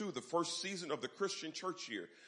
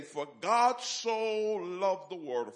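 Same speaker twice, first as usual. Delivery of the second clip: scripture quote; 6 semitones higher, 7 dB louder, much slower than usual. Shown as a demonstration. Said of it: "let me say it again".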